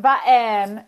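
"Button" with a glottal stop for T; glottalized T. Shown as a demonstration. Expert showing the Utah feature, glottal stop in "Button". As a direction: swap the T in "Button" for a catch, then "-en". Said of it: "the glottalization of T".

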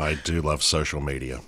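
dry, sarcastic understatement. Real, not acted. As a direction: say it with mock fondness. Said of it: "no need for sarcasm".

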